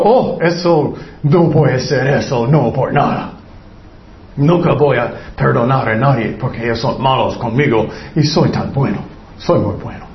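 smug whine of someone who never apologizes; whiny grown-up baby. Acted. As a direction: play a spoiled adult insisting he's good. Self-justifying grown-up baby.

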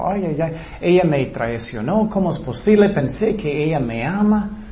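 normal talking voice

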